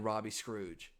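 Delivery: ordinary talk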